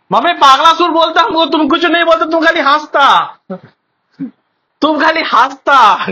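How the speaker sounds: high, very loud mock complaint; loud protest. Son protesting to mother about a nickname, loud and high.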